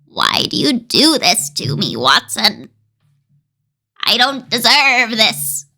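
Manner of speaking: wobbly bleating whine, like a goat. Acted. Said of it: "Now I sound like a goat from Babe".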